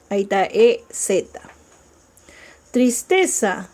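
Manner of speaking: ordinary talk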